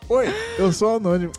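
anonymous persona voice. Pretend. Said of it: "o anônimo aqui vai falar".

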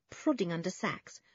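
ordinary delivery

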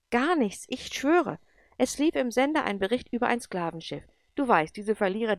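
put-on a boy protesting his innocence; agitated protest. Andrew defends himself anxiously. (aufgeregt und laut verteidigend)

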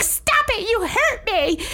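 high squeal of complaint; whiny victim voice. Retold or imitated. Staff girl complaining to counselor, high whiny squeal.

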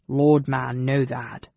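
slow, sulky goblin voice; sullen. A narrator's reading. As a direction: insist sulkily as a scolded goblin. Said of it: "Glob returned sullenly".

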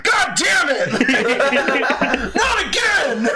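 high, loud, drawn-out outburst; hammed-up fed-up librarian; mock exasperated shout. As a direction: imitate a furious yell through laughter.

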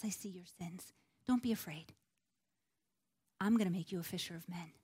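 soft, low reassurance with long pauses; gentle mercy. Acted. Jesus reassuring a fearful Simon Peter, soft and merciful. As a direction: quietly reassure someone who feels unworthy. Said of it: "Jesus looked at him with mercy".

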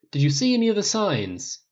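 eager press-pack questioning; reporter pressing. A narrator's reading. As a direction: press him for an answer.

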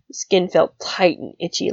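plain reading voice

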